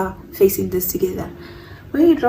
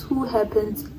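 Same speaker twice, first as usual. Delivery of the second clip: ordinary talk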